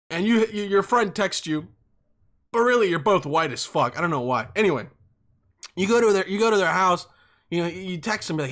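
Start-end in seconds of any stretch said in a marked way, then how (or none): none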